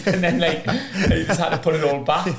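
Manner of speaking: telling it through laughter; laughing. Real, not acted. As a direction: laugh along while recounting the prank. Laughing through his own prank story.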